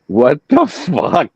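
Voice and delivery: high, drawn-out incredulous reaction; acted-out stunned exclamation; retold disbelief. Retold shocked reaction to screaming wife.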